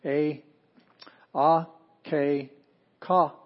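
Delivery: "a, ke, ka" spaced widely, one firm beat each; syllable by syllable. Shown as a demonstration. Teacher retrying the example, "a, ke, ka" sounded out separately. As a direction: leave a clear gap after each of "a, ke, ka". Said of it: "I'll try that again".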